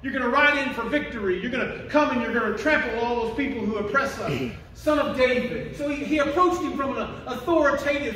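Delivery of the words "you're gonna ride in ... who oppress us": ordinary talk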